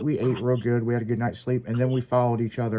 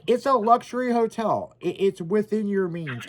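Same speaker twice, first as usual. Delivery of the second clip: higher-pitched mock reassurance; retold sales pitch; 6 semitones higher than usual. Partner pitching a hotel to him, mock salesman's assurance. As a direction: imitate someone talking up a dubious bargain.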